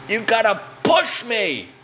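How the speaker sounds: put-on high, slow demanding old woman; old lady voice. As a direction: bark it up high like the stuck granny.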